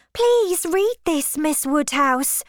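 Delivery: high, eager plea, slowed on the first words; pressing the letter on her friend; eager cry. Acted. Harriet begging Miss Woodhouse to read the letter, eager high plea. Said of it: "cried Harriet".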